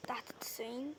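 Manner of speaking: hushed admiring whisper. Real, not acted. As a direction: breathe it out like savoring the shot. Commentating the goal replay.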